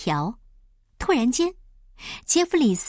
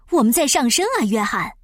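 elated cry; 4 dB louder than usual. Acted. Pilot to doctor as the balloon rises, elated. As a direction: exclaim with delighted relief.

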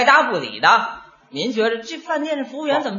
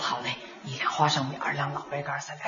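very soft, lower fast murmur; near whisper; 10 semitones lower, 14 dB quieter, faster than usual. Acted. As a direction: whisper the order secretively. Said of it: "小声啊".

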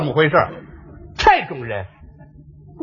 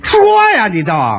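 impatient outburst; 6 semitones lower than usual. Acted. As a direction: push them to get on with it. Impatient listener demands the gossip. (不耐烦地拔高嗓门催促)

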